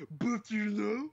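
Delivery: high, loud parody of an officer; hammed-up imitation of pompous authority; mock authoritative voice. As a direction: echo it back, exaggerated and rising. Listener mimics the authoritative voice.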